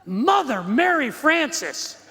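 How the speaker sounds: a hammed-up holy oath with a laugh in it; devout exclamation. Shown as a demonstration. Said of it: "you gotta say it like this".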